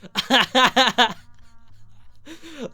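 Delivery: a sudden burst of high laughter; bursting out laughing; loud laugh. Real, not acted. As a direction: crack up at your own joke. Laughs at Coolidge cougar joke.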